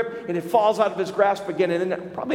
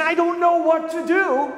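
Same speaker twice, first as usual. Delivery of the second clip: high, lost and helpless, pitched way up; clueless voice; 10 semitones higher, 4 dB louder than usual. Retold or imitated. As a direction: play the clueless person who has no idea. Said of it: "If you are clueless, you're saying".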